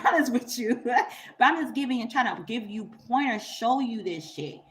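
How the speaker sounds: normal talking voice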